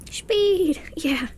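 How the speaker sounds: whispered exclamation. Acted. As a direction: hiss it out excitedly. Speeding up the timelapse.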